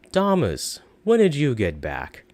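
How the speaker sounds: high, loud cheery greeting. Acted. Shopkeeper greeting returning Thomas, high and cheery. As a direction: welcome him warmly. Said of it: "his voice becoming cheery".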